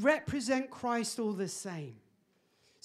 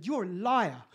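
louder, half-laughing call-out; retold accusation; 5 dB louder than usual. Man accuses server in front of queue, louder, half-laughing. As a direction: call her a liar in front of everyone. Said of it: "I said".

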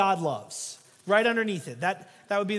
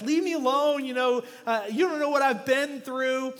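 put-on higher and louder, a drunk man snapping back; angry drunk; 5 semitones higher, 5 dB louder than usual. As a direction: shove the pastor off with your voice. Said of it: "the drunk started getting mad at him".

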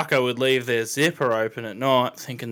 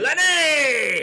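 loud, much higher shouted call across a camp; calling out to Lenny; 7 dB louder than usual. Retold or imitated. Mate quoting Red Dead Redemption's Arthur, mock shout for Lenny. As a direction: bellow the name like Arthur calling his mate.

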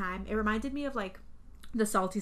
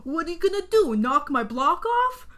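loud, raised, goading challenge; biker's loud taunt; 7 semitones higher, 9 dB louder than usual. Retold or imitated. Biker goading the clown before the uppercut, loud taunt. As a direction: dare them loudly, dragging the last words. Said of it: "the fucking guy says".

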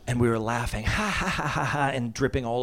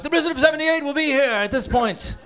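mock announcer; 12 semitones higher, 5 dB louder than usual. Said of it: "they were like".

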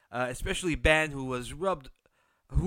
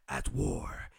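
dramatic whisper; 12 semitones lower than usual. Acted. Show title dropped to whisper.

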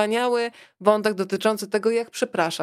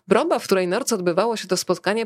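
usual speaking voice

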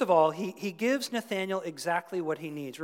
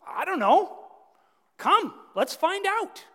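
much higher and louder, eager and inviting; eager Philip; 12 semitones higher, 8 dB louder than usual. Retold or imitated. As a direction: pull your friend along with excitement. Philip answers Nathanael's doubt.

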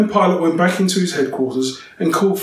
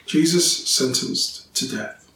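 ordinary delivery

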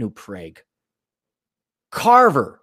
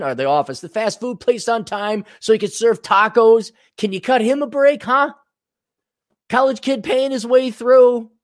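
pleading caller voice; 8 semitones higher than usual. Acted.

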